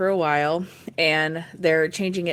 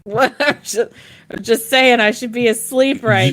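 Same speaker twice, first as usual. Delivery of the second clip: laughing; 6 semitones higher, 8 dB louder than usual. Real, not acted. Laughing while teasing cohost.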